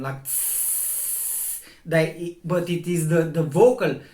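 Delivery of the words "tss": sustained voiceless "tss"; soft, stretched-out hiss; long consonant hiss. Shown as a demonstration. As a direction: hold the hissed consonant out long. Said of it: "You cannot make long a constant".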